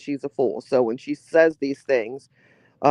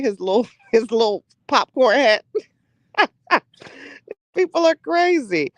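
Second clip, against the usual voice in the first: high giggling disbelief; laughing hard; 7 semitones higher, 3 dB louder than usual. Real, not acted. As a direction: laugh through the words. Laughing at popcorn hat.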